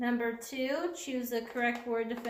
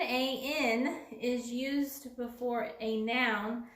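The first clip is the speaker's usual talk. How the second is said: slow, spelling A N; slow explanation; slower than usual. Shown as a demonstration. Teacher slowly explains when to use an. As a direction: lay it out slowly. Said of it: "So an".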